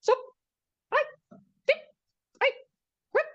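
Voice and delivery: tiny high squeaks with gaps between; high, short cartoon-like blips; tickle sound effects. A narrator's reading. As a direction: pop out playful ticklish noises one by one. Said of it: "la escalera hacía zip".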